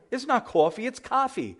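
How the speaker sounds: high-pitched Californian correcting New York "cawfee" to open "cahfee"; mock West Coast accent, vowel opened on the last coffee; Californian correction. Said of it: "when I go out to the West Coast".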